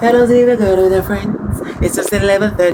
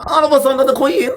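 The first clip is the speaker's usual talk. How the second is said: mock British claim; 9 semitones higher than usual.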